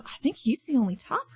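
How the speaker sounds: usual speaking voice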